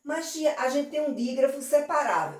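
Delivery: lightly voiced curious student; student asking teacher. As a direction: play the kid asking tia a question. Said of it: "ele diz assim".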